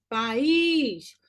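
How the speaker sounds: "País" stretched, weight on "ís"; separated syllables. Shown as a demonstration. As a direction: pull "País" apart, PA-ÍS, stressing the second. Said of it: "eu separo a sílaba". ("País" separado, com o "ís" mais forte)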